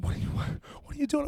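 fast, high, breathless whisper; alarmed whisper. Retold or imitated. Wife catching husband at night, fast alarmed whisper. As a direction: hiss it fast so as not to wake the house.

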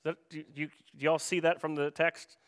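normal talking voice

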